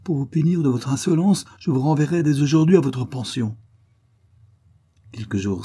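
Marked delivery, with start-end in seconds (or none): 0.0-3.6 high, indignant scolding; outraged threat, pitched high; angry. A narrator's reading. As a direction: pronounce the punishment in raised, angry tones. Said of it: "repartit la comtesse en rougissant de colère".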